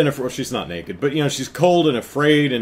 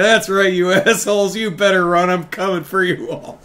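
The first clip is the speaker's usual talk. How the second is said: gloating yell; 7 semitones higher, 4 dB louder than usual. Retold or imitated. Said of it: "She's like".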